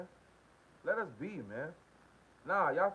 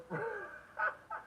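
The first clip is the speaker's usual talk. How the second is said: a real high laugh bursting out; high burst of laughter; 5 dB quieter than usual. Real, not acted. Laughing at a viewer's comment, high burst of laughter. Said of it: "You know why I'm laughing?".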